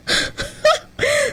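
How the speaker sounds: sudden squeaky burst of laughter; high laugh. Real, not acted. Laughing at the expert's advice, high squeak.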